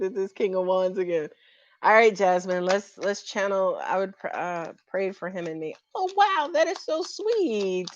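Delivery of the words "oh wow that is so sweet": high, slow, melting "so sweet"; touched surprise. Real, not acted. Reader touched by the client's sweet message, high and slow. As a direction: swoon over how sweet it is.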